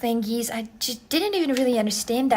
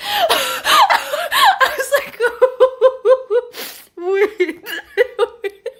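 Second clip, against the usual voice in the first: high, helpless laughter breaking up the words; real laughing fit; 12 semitones higher, 5 dB louder, much slower than usual. Real, not acted. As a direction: crack up and squeeze the words out. Cracks up retelling driver's question.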